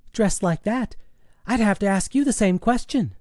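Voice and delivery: high daughter's voice, needling Mom; cheeky high-pitched daughter; teasing teenage daughter. Acted. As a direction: tease your mother in a high girlish voice. Daughter teases her mother.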